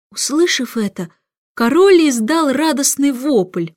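plain reading voice

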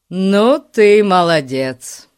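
loud, drawn-out delighted praise; admiring. A narrator's reading. Larry praises his friend's clever plan, loudly impressed. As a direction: stretch it out, full of admiration. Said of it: "восхитился Ларри".

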